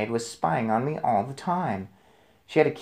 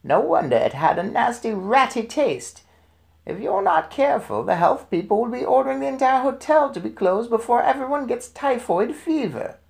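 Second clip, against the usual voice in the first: higher grandmother voice, scolding and insistent; stern grandmother; 11 semitones higher than usual. A narrator's reading. Grandmother warning the manager about health people, scolding. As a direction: pile on the threats about typhoid.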